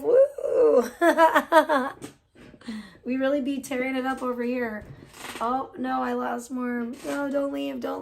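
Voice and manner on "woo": loud high whoop breaking into laughter; excited whoop. Real, not acted. Streamer cheering her viewer count, loud whoop and laugh.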